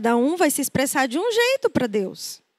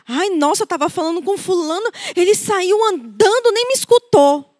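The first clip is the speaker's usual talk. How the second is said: rising, louder offended whine; aggrieved complaint; 5 semitones higher, 5 dB louder than usual. Retold or imitated. Quoting a hurt churchgoer, a whiny complaint that builds louder. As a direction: sound hurt that nobody listened. Complaining person quoted in sermon.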